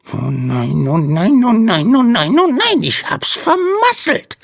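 very high, frantic wail climbing steeply; squealed lament, building in pitch; squeaky dismayed little creature. A narrator's reading. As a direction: wail it as a tiny panicking sandman. Distressed creature wails about its blunder.

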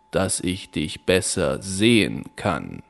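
wolf in granny's bed answering low; wolf as grandmother. Acted. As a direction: purr it back with hidden menace.

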